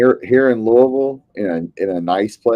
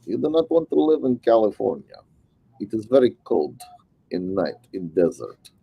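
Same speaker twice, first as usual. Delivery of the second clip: put-on Russian accent, stress on not; deadpan Russian accent warning; mock Russian accent; 4 dB quieter than usual. Warning a friend off California, deadpan Russian accent.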